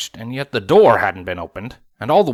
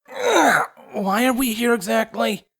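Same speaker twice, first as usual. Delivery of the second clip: put-on slow, puzzled higher boy voice; young dragon voice; 10 semitones higher, slower than usual. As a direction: ask it like a curious kid. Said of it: "Spike asked".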